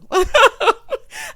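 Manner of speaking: big high whooping laugh; loud cackle. Real, not acted. Vlogger cackling at her own guilty pleasure. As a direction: burst out cackling.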